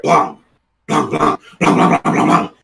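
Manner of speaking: a fast, very loud burst of gunshot bangs; a whole burst of shots going off, loud and rapid; rapid gunfire. Retold or imitated. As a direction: rattle the bangs off like a spray of wild shooting. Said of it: "But if you hear".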